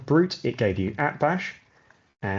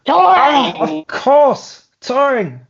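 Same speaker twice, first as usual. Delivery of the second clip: very high, very loud exclamation; over-the-top, high-pitched shouted revelation; villain professor character voice; 12 dB louder, much slower than usual. Acted. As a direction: cry out like a hammy villain. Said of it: "the accent for this chap".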